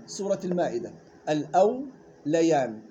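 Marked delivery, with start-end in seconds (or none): 1.3-2.8 الأوليان slowly, wāw still, lām and yā with fatha; careful recitation. Shown as a demonstration. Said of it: "سكون الواو وفتح اللام والياء".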